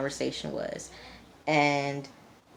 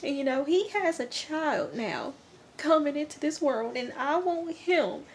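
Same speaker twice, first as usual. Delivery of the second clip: high, teary imitation of a crying mom; a hammed-up tearful country mom; mock crying mom; 12 semitones higher than usual. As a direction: plead in a pitched-up, mock-weepy mom voice. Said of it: "she's crying".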